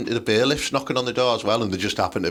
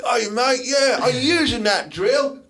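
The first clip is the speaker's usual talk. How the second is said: mock builder; 13 semitones higher than usual. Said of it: "On the Tools skit".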